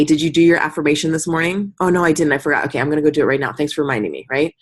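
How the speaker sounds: ordinary talk, nothing marked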